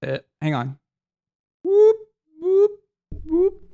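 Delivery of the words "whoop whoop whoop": short, very high-pitched whoops; three little rising sound effects; playful high hoots, evenly spaced; high "whoop" noises. Real, not acted. Imitated noise while doing something onscreen.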